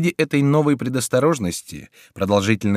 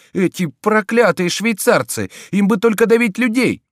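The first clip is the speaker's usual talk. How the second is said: higher and louder, building to a high cry; Friar, from thanks to panic; 6 semitones higher, 5 dB louder than usual. A narrator's reading. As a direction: start grateful, then rise into alarm.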